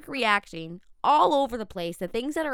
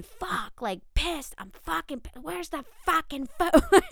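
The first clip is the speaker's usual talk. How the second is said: high-pitched pissed outburst, retold and hammed-up; 7 semitones higher than usual. Retelling her reaction to missing dog food, mock pissed. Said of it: "I jolted into reaction going".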